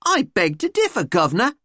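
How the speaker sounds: exaggerated cockney retort; hammed-up cockney. A narrator's reading. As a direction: put on a cheeky cockney accent. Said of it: "common or garden English accent".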